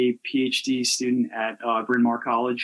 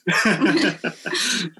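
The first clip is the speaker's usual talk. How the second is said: amused laugh; 7 semitones higher, 3 dB louder than usual. Real, not acted. Laughs at own boring topic.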